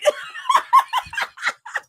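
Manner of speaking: shrieking burst of laughter; real high cackle. Real, not acted. Laughing at own text.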